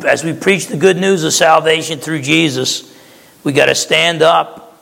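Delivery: normal talking voice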